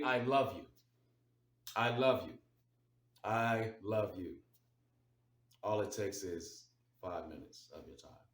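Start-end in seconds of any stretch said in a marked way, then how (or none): 0.0-4.4 louder heartfelt declaration, slowing on the last one; earnest I love you. Shown as a demonstration. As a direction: say it like you really mean it. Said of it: "I just call to say".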